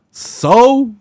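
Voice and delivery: high, loud mock "So?"; mock retort.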